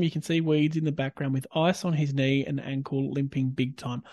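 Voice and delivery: usual speaking voice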